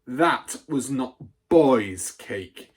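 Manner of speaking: loud, high, outraged accusation; furious rant. A narrator's reading. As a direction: rant at the boy before the school. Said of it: "the Trunchbull shouted".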